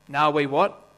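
usual speaking voice

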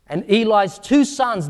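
ordinary talk, nothing marked

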